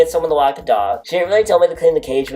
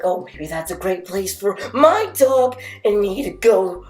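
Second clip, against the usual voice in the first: mock bright voice; 7 semitones higher than usual. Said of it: "I'm just like".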